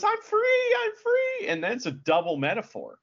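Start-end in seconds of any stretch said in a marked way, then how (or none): 0.0-1.5 high-pitched mock cry of release; small high jubilant call; acted cry of freedom. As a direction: squeal it like you've just been let out of a cage. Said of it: "he comes out and says".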